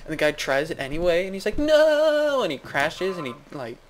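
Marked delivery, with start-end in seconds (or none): 1.6-2.4 long, high, loud "no"; drawn-out cartoon cry of horror; mock horrified cry. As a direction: wail it as disaster strikes, hammed up. Hero watches the disastrous attempt.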